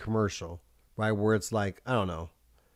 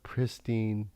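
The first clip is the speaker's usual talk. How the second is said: normal talking voice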